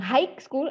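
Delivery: "high" with a k stuck on, "haik"; wrong pronunciation. Shown as a demonstration. Teacher showing students what to avoid, wrong pronunciation. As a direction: say "high school" as "haik skul". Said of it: "tapi bukan high school".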